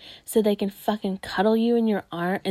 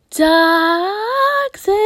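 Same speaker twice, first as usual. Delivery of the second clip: drawn-out mock yell; 10 semitones higher, 8 dB louder than usual. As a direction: wail the word toxic in a high, loud voice. Chants the word in contrasting voices.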